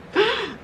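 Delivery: short squeaky laugh; high laugh. Real, not acted. Laughing at her own story, high laugh.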